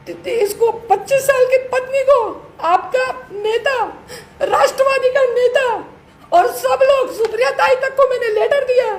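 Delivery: high, breaking voice, near tears; pushing the words out through tears; tearful voice. Real, not acted. Wife tearfully accusing husband publicly. (ऊँची, टूटती, रोती हुई आवाज़)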